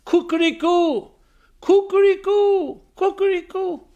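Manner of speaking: high drawn-out rooster call; slow, very high cock-a-doodle-doo; rooster crow. Acted. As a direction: crow it like a farm boy's rooster. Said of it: "sound of a rooster".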